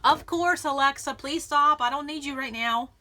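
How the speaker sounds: loud, high call across the room; real exasperated shout; shouting at Alexa. Real, not acted. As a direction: yell at the device to stop. Alexa device going off.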